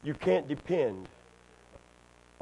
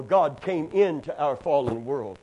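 ordinary delivery

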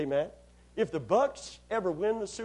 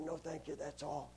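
worn-out, hoarse and much softer; 2 semitones lower, 15 dB quieter than usual. Retold or imitated. Preacher at a 7-Eleven counter, hoarse after worship. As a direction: talk like you've preached your voice away. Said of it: "this voice like this".

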